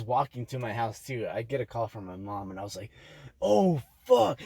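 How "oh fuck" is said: high, loud startled swear; panicked curse. Retold or imitated. Caught sneaking home by her mom's call, a panicked high curse. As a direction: yelp it like you just got caught.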